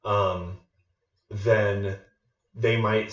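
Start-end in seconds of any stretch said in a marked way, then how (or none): none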